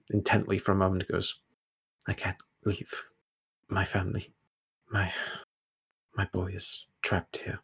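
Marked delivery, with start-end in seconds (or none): 2.1-7.6 soft, halting near-whisper of a frightened woman; terrified. Acted. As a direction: barely get it out, with fearful pauses. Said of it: "she is absolutely terrified".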